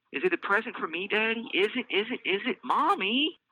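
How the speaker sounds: put-on high pleading child's squeal; excited squeal. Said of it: "Elise squeals".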